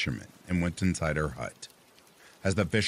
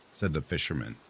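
usual speaking voice

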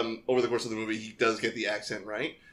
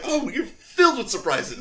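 mock flirty; 13 semitones higher, 5 dB louder than usual. Retold flirty movie line.

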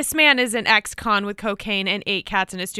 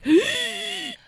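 gasp. Retold or imitated. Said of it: "I was like".

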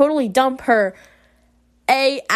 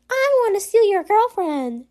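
put-on mean girl voice; 7 semitones higher than usual. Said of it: "that girl who was like".

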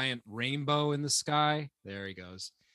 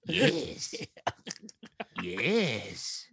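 leprechaun voice; 4 semitones lower than usual. Acted. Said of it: "is that your leprechaun voice?".

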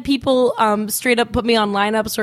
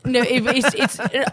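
normal talking voice

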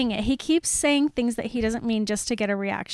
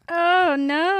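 higher, louder cry of dismay; appalled; 6 semitones higher, 5 dB louder than usual. Real, not acted. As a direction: cry out, appalled at what you hear. Appalled at a line in book.

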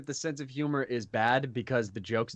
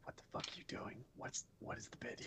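hushed, voiceless aside; urgent whisper; 20 dB quieter than usual. Acted. Rogue privately questioning a companion mid-scheme, whispered.

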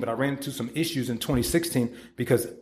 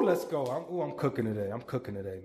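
usual speaking voice

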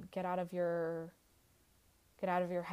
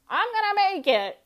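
shouted high and loud, full of defiance; triumphant I'll-show-you cry; defiant shout; 12 semitones higher, 12 dB louder than usual. Retold or imitated. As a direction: yell it back at the doubters. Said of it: "some people are like".

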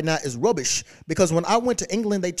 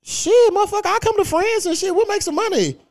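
high, loud excited pitch; hyped-up offer; 12 semitones higher, 4 dB louder than usual. Real, not acted. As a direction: sell the idea all fired up.

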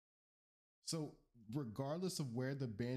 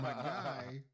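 full New Yorker. Acted. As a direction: throw on a brash New Yorker voice. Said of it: "full New Yorker".

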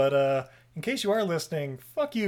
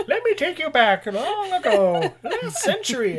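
put-on high, slow laughing old-timer voice; hammed-up storyteller of long ago; mock old-timer; 11 semitones higher, 4 dB louder than usual. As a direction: drawl it out like a laughing grandpa recalling the old days.

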